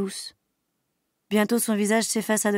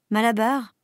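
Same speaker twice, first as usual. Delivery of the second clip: ordinary delivery, nothing marked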